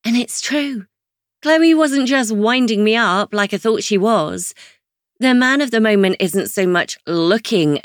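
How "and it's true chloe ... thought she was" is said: usual speaking voice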